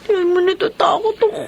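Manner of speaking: crying out her fear; frightened, near tears. Acted. Ellie tells Telmo she is afraid, crying. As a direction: voice breaking with fear.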